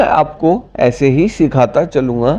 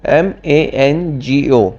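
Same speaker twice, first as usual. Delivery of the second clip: slow spelling; much slower than usual. Shown as a demonstration. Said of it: "spells word letter by letter".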